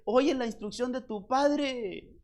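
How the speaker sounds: mamá que aconseja, aguda y algo lenta; da el consejo como una mamá; voz de mamá. Acted. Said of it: "Porque es la mamá". (la mamá aconseja al hijo, aguda y pausada)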